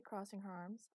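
ordinary delivery, nothing marked

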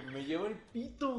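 a slow, hammed-up lament over the vanished bouncy castle; drunk dismay. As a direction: drag it out like a lost drunk. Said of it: "Y dije".

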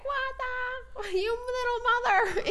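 high, slow scolding shriek; hammed-up furious mother; mock angry mom. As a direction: yell down at the kids, slowly. Mom yelling at kids in pool.

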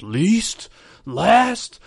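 high, outraged squeak of disbelief; mock indignant giant; offended Strowman. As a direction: play the giant hurt by a phrase. Offended Strowman repeating insult.

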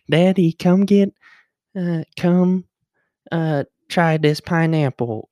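high-pitched imitation of a small child; baby voice. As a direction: put on a slow, high toddler voice. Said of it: "them in the baby voice".

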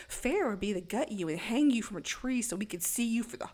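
ordinary talk

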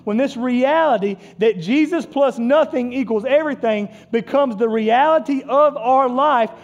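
fervent pulpit delivery; impassioned preaching. Real, not acted. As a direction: preach it high and strong, punching key words. Preaches at full force.

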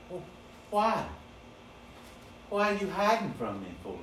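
high, searching why; pleading question. Acted. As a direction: ask like a hurt father. God questioning hiding Adam.

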